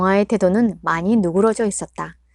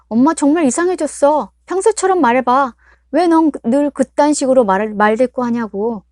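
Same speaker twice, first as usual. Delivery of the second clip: loud, high exasperated complaint; irritated daughter; 5 semitones higher, 6 dB louder than usual. Acted. Daughter rejects her mother's new gentle tone, loud exasperation. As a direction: push her mother's odd kindness away.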